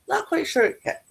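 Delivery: hiccuping mid-sentence; a hiccup breaking the words. Real, not acted. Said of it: "I got the hiccups".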